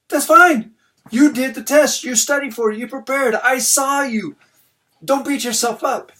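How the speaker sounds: high, loud, upbeat encouragement; enthusiastic reassurance. Retold or imitated. Dad encouraging his upset daughter, high and enthusiastic. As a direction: lift her spirits loudly.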